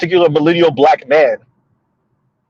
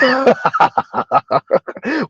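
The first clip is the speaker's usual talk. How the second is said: high laugh at a joke; tickled laughter running on and rising; real laugh; 7 semitones higher than usual. Real, not acted. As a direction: laugh freely at the viewer's joke.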